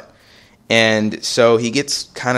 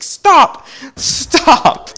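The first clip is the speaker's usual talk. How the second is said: very high, loud strained cry; mock screech; 6 dB louder than usual. Mom storms the basketball court, screeching. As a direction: shriek it to halt everyone. Mom halting the game, retold.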